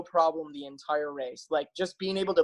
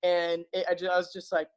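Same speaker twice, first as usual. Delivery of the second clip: usual speaking voice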